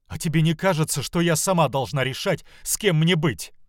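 high, loud retort from a cornered daughter; acted indignant defiance; defiant protest. Daughter defies her mother.

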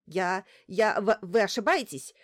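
loud, stumbling panicked denial; frightened stammer. Acted. Doctor backing away at gunpoint, stammering in fear. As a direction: stutter out the protest in fear. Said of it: "быстро заговорила Бочкарёва, пятясь к столу".

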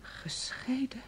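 breathless, slow, whispered disbelief; a shaken whisper of disbelief; stunned whisper. Acted. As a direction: barely get the word out, slowly, in shock. Learns her lover lied.

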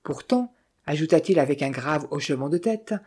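ordinary delivery

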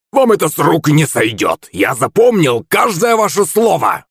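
put-on high, furious old man's warning; huffing, high-pitched outrage; growled threat. A narrator's reading. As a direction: threaten indignantly, pitch climbing. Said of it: "прорычал старикан, пыхтя как паровоз".

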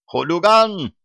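high, loud groaning complaint; groaning wolf. A narrator's reading. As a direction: moan it like a battered wolf. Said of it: "стонал Серж".

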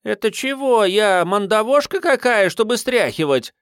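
high, offended urchin voice; offended street urchin. Acted. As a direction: take offence like a street kid. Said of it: "оскорбилось дитя улицы".

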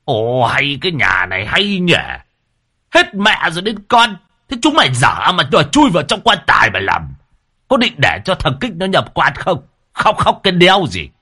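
high, loud angry elder; glaring old man's scolding. A narrator's reading. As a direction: scold the whole family, building to a shout. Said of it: "Ông già vừa nãy lại trợn mắt".

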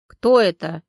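loud, startled male voice; a man caught off guard on the phone; surprised man. A narrator's reading. Stranger answering Valeria's call, loud surprised man. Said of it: "спросил удивлённый мужской голос".

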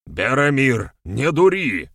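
loud, high, urgent call; exclaiming. A narrator's reading. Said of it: "воскликнул Вернедуб". (громкий, тревожный возглас)

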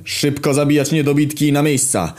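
high-pitched urgent command; shouted orders. A narrator's reading. Rebel leader ordering men to their places, high shout. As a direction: cry out orders to the whole group. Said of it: "Wstałem i krzyczałem".

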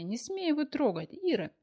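high pleading shriek. Acted. Anya shrieking at a fighter.

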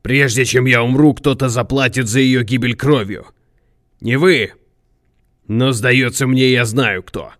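forceful, grim threat; intense, menacing declaration; vengeful vow. A narrator's reading. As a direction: swear revenge with weight on every word. Rival vows bloody revenge.

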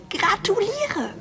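high, drawn-out congratulations; überschwänglich gratulierend. Acted. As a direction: stretch it out high, gushing to a rival. Gushing congratulations to rival. (übertrieben hoch gedehnter Glückwunsch)

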